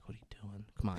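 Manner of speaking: urgent hushed whisper. Retold or imitated. Mom whispers at the dad to stop. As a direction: hiss it under your breath. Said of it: "the mom's like".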